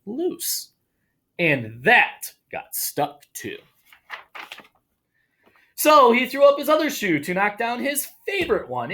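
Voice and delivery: animated storytime narration; story reading. Acted. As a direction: read it like a children's picture book. Reads picture book aloud.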